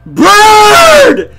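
high, loud hammed-up wail; drawn-out scream. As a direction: scream it long at the sight. Screams on seeing the bird.

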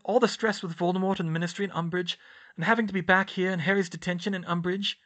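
fast, high, tumbling excuses; rambling. A narrator's reading. Hermione babbling excuses to Dumbledore, fast rambling. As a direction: let the words run on without a breath. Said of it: "she was rambling again".

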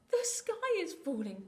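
high, mildly puffed chick cry; lightly acted flustered chick; puffed alarm. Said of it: "puffed Chicken Licken".

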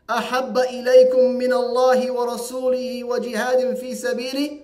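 fast, steady Arabic recitation; تلاوة سريعة; Quran recitation. Acted. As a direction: recite the verse rapidly in one flow.

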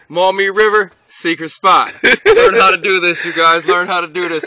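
loud, hyped brag. Real, not acted. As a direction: brag to the viewers. Hyped boast to viewers.

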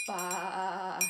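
low, drawn-out "baa"; sheep bleat. Pretend. Host showing kids Mary's lamb, playful sheep bleat.

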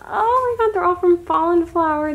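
high and loud, bursting with joy; thrilled. Real, not acted. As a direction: squeal it out to the camera. Thrilled by freebies.

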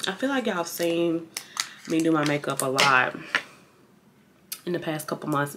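soft talk while chewing gum. Real, not acted. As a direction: chew gum between the words. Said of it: "I'm chewing gum".

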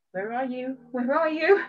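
mock searching call, rising on the repeat; impatient searching. Speaker looking all week for the promised man, comically impatient.

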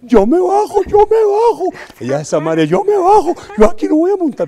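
very high, loud, frantic mock screech; hammed-up hysterical woman shrieking to get out; panicked screech. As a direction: squeal like a terrified passenger demanding out. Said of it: "pero así".

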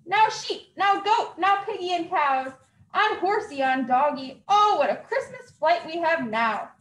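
ordinary delivery, nothing marked

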